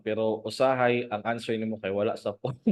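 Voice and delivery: usual speaking voice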